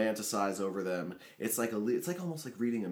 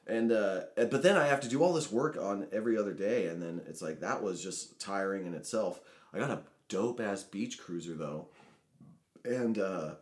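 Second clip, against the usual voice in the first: normal talking voice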